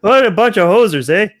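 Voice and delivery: loud, high, hammed-up Canadian accent. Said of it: "that's the best Canadian accent".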